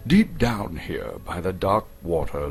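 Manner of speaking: plain reading voice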